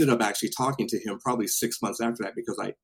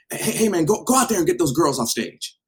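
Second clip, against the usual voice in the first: urgent order; 6 semitones higher, 4 dB louder than usual. Retold or imitated. Acts out boss's urgent order.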